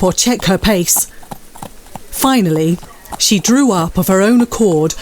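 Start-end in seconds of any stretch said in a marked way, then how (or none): none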